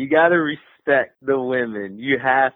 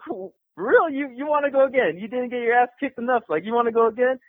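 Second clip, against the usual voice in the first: mocking disbelief; 9 semitones higher than usual. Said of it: "And I'm like".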